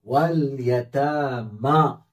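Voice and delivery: stretched, careful read of वल यतामा; slow Quranic reading. Shown as a demonstration. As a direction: recite वल यतामा slowly, holding the long ता and मा. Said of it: "मीम खड़ा जबर मा".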